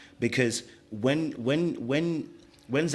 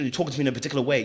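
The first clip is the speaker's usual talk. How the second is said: ordinary talk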